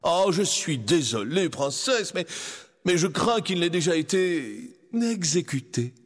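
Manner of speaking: slow, mock-sorry villain; sneering vizier. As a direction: smile through false regret. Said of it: "Il sourit méchamment". (vizir sournois et faussement désolé)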